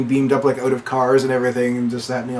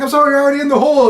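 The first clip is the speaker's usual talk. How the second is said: fast, high brush-off; hurried apology; 13 semitones higher, 5 dB louder, much faster than usual. Retold or imitated. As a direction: rattle off a quick sorry. Craig Robinson running past.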